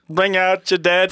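slow cart call through a laugh; laughing as he drawls the corpse collector's line; movie plague cart call. Retold or imitated. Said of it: "I love that scene".

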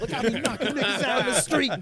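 high, loud hype yell; mock promo shout. Friend imagining mixtape promotion, mock hype shout. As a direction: holler it like a street video boast.

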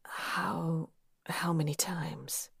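dry, breathless whisper. A narrator's reading. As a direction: breathe it out like wind over sand. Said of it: "my voice whispered like wind".

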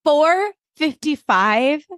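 high, drawn-out time stamp; editors' time caption. Retold or imitated. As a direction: stretch out a mock caption. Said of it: "I love the editors go".

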